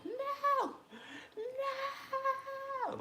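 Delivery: put-on very high childish crying no; tantrum wail. Mimicking the viral ice cream girl, very high tantrum wail. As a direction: wail like a crying toddler refusing. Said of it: "Like that girl with the ice cream".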